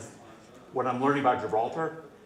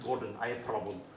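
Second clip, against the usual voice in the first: lower, softer imitation of a Ukrainian accent; mock Ukrainian accent asking for help; 4 semitones lower, 9 dB quieter than usual. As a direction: do the Ukrainian caller's broken English. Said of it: "and I'll do the accent".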